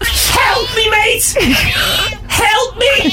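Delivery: put-on high squeaky Scouse accent shout; Scouse cat voice. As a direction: yell for help as a Scouse cat. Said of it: "a cat from Liverpool with a Scouse accent".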